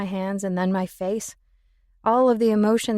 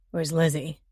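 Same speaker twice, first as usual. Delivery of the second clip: lower, abrupt man's voice; muffled male voice; 5 semitones lower than usual. Acted. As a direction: call out abruptly from the next room. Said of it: "asked a muffled voice in the next room, male and abrupt".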